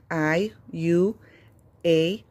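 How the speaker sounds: "I, U, A" said as the letter names; long vowel names. Shown as a demonstration. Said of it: "es el nombre de la vocal".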